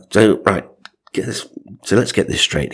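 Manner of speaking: normal talking voice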